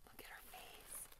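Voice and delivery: hushed, breathy awe; whisper. Real, not acted. Admiring the doll's face in a hushed whisper. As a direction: whisper it like she might wake.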